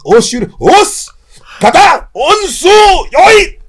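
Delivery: high, slow, barked martial arts calls; mock dojo commands. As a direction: shout out each kata word like an overzealous student. Mimicking dojo responses and kata calls.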